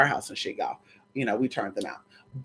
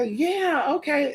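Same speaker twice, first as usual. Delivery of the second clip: high, soft coy yes; coy acceptance; 9 semitones higher, 4 dB quieter, much slower than usual. Retold or imitated. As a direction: accept sweetly, higher pitch. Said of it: "And I'll say".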